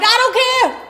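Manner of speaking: high, loud whiny refusal; a man losing it in the car; mock outburst. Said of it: "Barry like".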